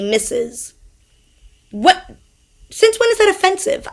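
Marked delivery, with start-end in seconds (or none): 1.7-3.9 incredulous. Real, not acted. Incredulous rant question.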